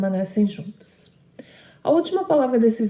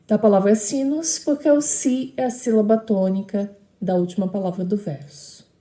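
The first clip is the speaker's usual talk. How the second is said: ordinary talk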